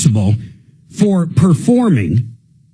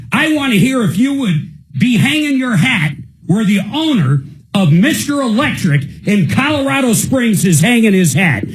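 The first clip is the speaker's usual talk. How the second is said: higher, heated, scolding the contractor; heated rant; 6 semitones higher than usual. Real, not acted. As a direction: let the indignation climb.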